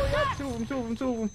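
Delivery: high, rushed enemy callout; urgent callout. Real, not acted. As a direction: call out the enemies fast and high.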